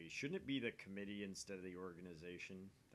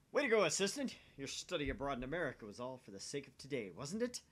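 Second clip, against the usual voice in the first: loud, high, fast hammed-up jeer; mocking taunt; 5 semitones higher, 9 dB louder, faster than usual. Scientist loudly mocking his assistant's English, hammed-up jeer. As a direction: crow at her like a mad scientist.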